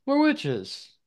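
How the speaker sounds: proud witches. Acted. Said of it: "And they were like".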